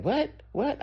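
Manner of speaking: just woken and can't take it in; confused squeak of disbelief; startled confusion. Retold or imitated. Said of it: "I was like".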